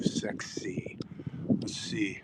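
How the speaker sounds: soft teasing whisper; hushed mock-sultry whisper. Real, not acted. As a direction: whisper it cheekily. Joking whisper about the statue.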